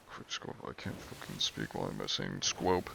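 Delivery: breathy under-the-breath whisper; frustrated whisper at own tongue; whisper to self. Real, not acted. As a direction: mutter it to yourself, barely voiced. Muttering to himself after fumbling.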